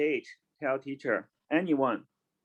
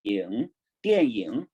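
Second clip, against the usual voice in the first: slow Chinese word; 6 semitones lower, slower than usual. Shown as a demonstration. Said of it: "Movie in Chinese is".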